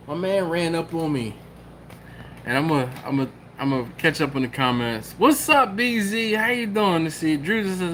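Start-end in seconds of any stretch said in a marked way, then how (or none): 5.2-7.1 excited greeting. Acted. Greeting a viewer, voice raised.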